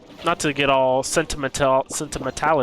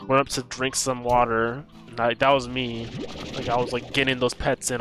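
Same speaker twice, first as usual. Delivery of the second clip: ordinary delivery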